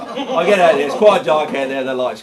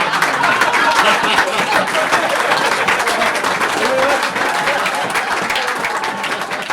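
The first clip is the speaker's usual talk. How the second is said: loud laugh; 6 semitones higher, 6 dB louder than usual. Real, not acted. Laugh after punchline.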